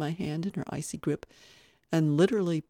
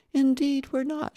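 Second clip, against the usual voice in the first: quick, higher retort; brisk retort; 10 semitones higher, 5 dB louder than usual. A narrator's reading. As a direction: toss the answer back briskly. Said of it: "she retorted".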